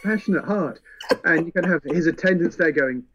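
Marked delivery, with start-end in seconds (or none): 0.0-0.8 higher, soaring over-earnest praise; hammed-up sincere young lord; earnest Arland. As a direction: gush about the gruff uncle like a sweet fool. Arland praising gruff uncle.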